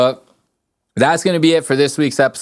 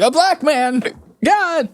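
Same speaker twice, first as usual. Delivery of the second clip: very high mock horror; imitating a frightened man, high and strained; mock panic; slower than usual. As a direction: squeak it out in a fright. Scared victim voiced in retelling.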